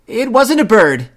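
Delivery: put-on high, loud child blurting out; blurted confession. A narrator's reading. Sister Bear confessing to Mama, blurting loudly. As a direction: burst out with the truth.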